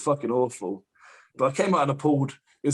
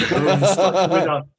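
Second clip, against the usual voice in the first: laughing; 4 semitones higher, 8 dB louder, much slower than usual. Real, not acted. Laughing while retelling failed squat.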